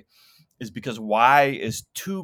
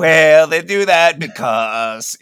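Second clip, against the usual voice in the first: loud, slow, higher comic impression; Ronald Reagan; 4 semitones higher, 11 dB louder, slower than usual. Bobby the expat explaining local customs, slow Reagan impression. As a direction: drag it out like a folksy president. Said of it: "Ronald Reagan is actually Bobby the expat".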